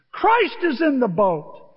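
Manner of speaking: loud, slow triumphant shout; shout of victory. Acted. Disciples crying out in victory, a loud slow shout. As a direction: cry it out like a victory call. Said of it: "goes the shouts".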